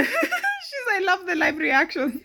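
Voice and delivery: high-pitched words breaking into a real laugh. Real, not acted. Cracking up at a friend's live reactions, laughing. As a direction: talk through high, loud laughter.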